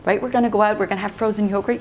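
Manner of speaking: plain reading voice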